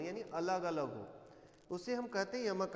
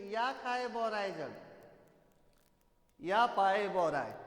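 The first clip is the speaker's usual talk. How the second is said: slow recited line, stretched out; verse recitation; 4 dB louder, slower than usual. Shown as a demonstration. Teacher pointing pupils to the second line, slow verse recitation. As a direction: draw out each word of the verse. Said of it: "दूसरा पंक्ति पे ध्यान दीजिए".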